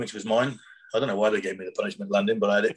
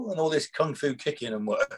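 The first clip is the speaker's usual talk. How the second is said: normal talking voice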